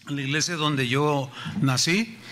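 ordinary talk

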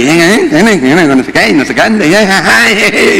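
put-on teasing girl's prattle; mocking chatter. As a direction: mimic a chatterbox rambling on. Mimicking the chatty girlfriend.

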